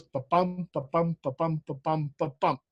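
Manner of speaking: ba-bum ba-bum chanted softly, light then strong; iambic rhythm. Shown as a demonstration. Teacher chanting five iambs to the student. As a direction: tap out the beat, da-DUM. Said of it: "five of them in a row goes".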